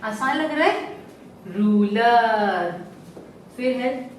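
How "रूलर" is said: रूलर with a long drawn-out रू. Shown as a demonstration. Teacher to class, stretching रूलर slowly. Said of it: "अब जल्दी जल्दी पढ़ना".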